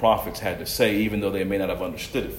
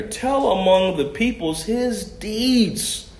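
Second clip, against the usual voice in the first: slow emphatic scripture quote; 10 semitones higher, much slower than usual. Acted. Preacher repeats psalm line.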